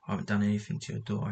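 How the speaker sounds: ordinary delivery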